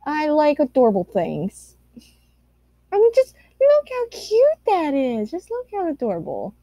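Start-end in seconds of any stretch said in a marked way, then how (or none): 2.9-6.5 much higher gushing coo; cutesy baby talk. Acted. Showing viewers cute fan art, high gushing baby voice. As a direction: squeal it like baby talk over something adorable. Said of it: "Ladle, why are you talking like this?".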